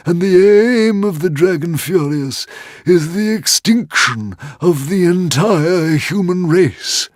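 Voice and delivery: high, loud, slow doom-laden dragon; dire warning. A narrator's reading. Old dragon warning Hiccup of extinction, high and dire. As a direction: tell it like a prophecy of doom.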